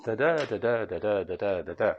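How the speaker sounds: softly chanted da DA, stress on each second "da"; quiet metrical demo, every second "da" longer; iambic pentameter. Shown as a demonstration. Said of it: "Does that sound natural to you?".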